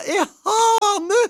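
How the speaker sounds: very high, loud gushing; wife thrilled at the rooster; ecstatic cry. A narrator's reading. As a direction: shriek with delight. Wife's delighted voice.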